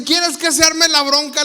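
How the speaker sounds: loud, high, slow mock challenge; angry challenge. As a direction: pick a fight, stretching the words out loudly. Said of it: "le contesta enojado". (retando enojado, en voz alta)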